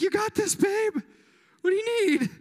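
lightly acted supportive husband, pitched high; encouraging husband. Husband retelling how he cheered on his pregnant wife, mock-encouraging.

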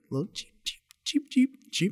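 high squeaky chirping cheeps; cartoon bird voice. Shown as a demonstration. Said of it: "birds start chirping".

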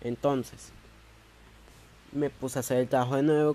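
ordinary talk, nothing marked

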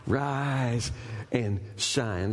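low, very slow rise and shine; slow solemn call. Acted. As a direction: stretch each word like a proclamation.

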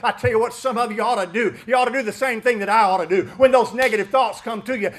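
ordinary talk, nothing marked

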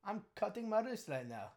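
high, singsong put-on Irish accent. Shown as a demonstration. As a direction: do a lilting mock Irish accent. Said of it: "Let me hear your Irish accent".

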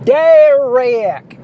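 loud, high cartoonish Southern holler, vowels stretched; mock Southern mother hollering for her son; cartoonish Southern twang. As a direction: call the name out in a drawn-out Southern twang. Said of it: "it's cartoonish. She would say".

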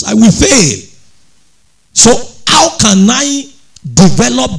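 high, loud preaching, pitch climbing; full-force preaching. Acted. Pastor urging the congregation to love through God, preaching loud and high. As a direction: preach it hard, lifting the congregation.

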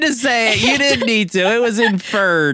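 squeaky high-pitched teasing voice; mock high teasing. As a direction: tease him in a silly high voice. Teasing co-host about rating.

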